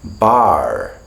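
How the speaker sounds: "bar" with a full American R; American-accented "bar", R pronounced at the end; American rhotic accent. Retold or imitated. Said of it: "an American will say bar".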